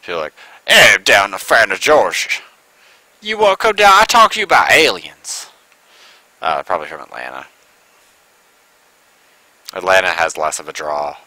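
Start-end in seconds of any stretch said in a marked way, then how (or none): 0.7-5.5 mock Georgia accent. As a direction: ham up a bad Georgia twang. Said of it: "That was the worst Georgia accent".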